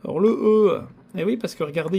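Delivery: ordinary talk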